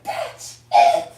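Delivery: a loud, sharp mock yell of her line; mock angry shout. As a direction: shout it like the angry woman he's imitating. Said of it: "She's like".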